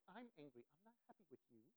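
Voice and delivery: very soft mock-angry son; hushed, put-on complaint; Nathaniel. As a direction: imitate a child's joking grumble under the breath. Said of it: "in that voice".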